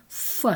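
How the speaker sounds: a lone "f" sound; Russian f. Shown as a demonstration. Lecturer naming the sound for Greek words, Russian f. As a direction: say "f" as a separate Russian consonant. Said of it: "rendered by the Russian".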